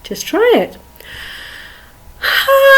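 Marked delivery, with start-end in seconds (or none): 2.2-2.8 much higher, held ha; high, easy warm-up ha. Shown as a demonstration. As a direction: float a high ha. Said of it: "even higher if you want to".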